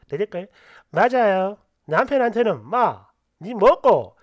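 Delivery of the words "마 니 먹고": slow, drawn-out Gyeongsang-dialect retort; a light Busan-accent jab at the husband. Pretend. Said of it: "이렇게 하시고". (느리게 끄는 경상도 사투리 말투)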